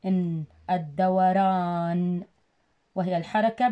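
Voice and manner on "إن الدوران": الدوران said slowly with the ل silent: ad-da-wa-ran; careful slow teaching read; slow blended word. Shown as a demonstration. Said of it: "الد شمسية أيضًا تكتب ولا تلفظ".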